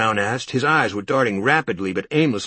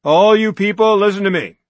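much higher, much louder bellow; 11 semitones higher, 12 dB louder than usual. Acted. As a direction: roar at the whole crowd to listen. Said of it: "Norton bellowed".

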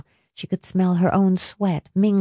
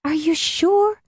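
anxious; 11 semitones higher than usual. Acted. She doubts his reassurance.

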